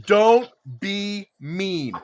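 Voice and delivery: each word drawn out slowly; slow, deliberate reading. Retold or imitated. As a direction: spell out the sticker slowly. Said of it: "three words on it".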